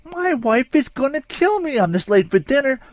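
hammed-up suspect crying about his wife. A narrator's reading. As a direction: wail about the wife in mock dread. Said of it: "he cried".

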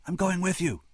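clinging to his father; scared boy. A narrator's reading.

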